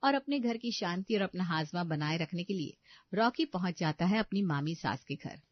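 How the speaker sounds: ordinary delivery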